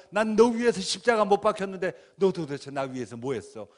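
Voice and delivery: imagined Jesus asking. Retold or imitated. Jesus confronting the preacher, stern. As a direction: demand what he did for you. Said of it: "예수님 제 앞에 짠 나타나서".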